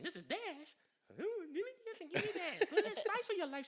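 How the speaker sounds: high-pitched, playful product pitch; commercial pitch. Retold or imitated. Performing a favorite commercial for the audition, hammed-up sales pitch. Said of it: "What's your favorite commercial".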